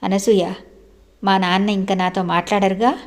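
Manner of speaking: plain reading voice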